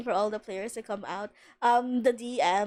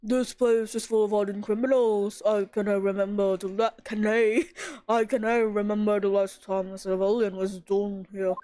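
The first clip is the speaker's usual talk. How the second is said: prison governor voice; 3 semitones lower than usual. Acted. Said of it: "what voice do I give this guy?".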